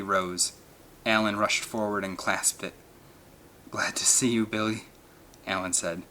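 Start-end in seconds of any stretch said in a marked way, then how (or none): 3.7-4.9 choked with emotion. A narrator's reading. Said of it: "His voice was choked with emotion".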